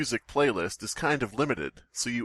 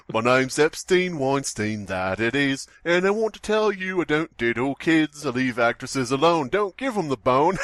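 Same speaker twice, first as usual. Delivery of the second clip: put-on British accent; 3 semitones higher, 4 dB louder than usual. Unlucky namesake protesting his innocence, hammed-up British accent. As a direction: belt it out as a cartoon Brit. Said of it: "I don't know why he's British".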